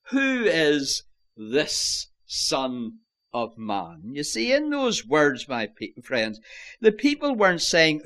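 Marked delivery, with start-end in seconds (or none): 0.1-4.1 very slow, with "this" drawn out and set apart; stress on THIS. Shown as a demonstration. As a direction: dwell on "this", spacing out the words around it. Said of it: "place the emphasis on that word".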